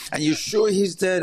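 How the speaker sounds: mock her insistent doubt; imitating the attorney. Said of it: "attorney quoted again".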